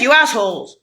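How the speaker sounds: usual speaking voice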